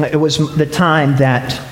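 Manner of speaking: low, halting, grave telling; sombre. Real, not acted. Preacher telling the congregation of his uncle's fatal hunting mistake, sombre. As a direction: drop low and let the pauses weigh. Grave true family tragedy after jokes.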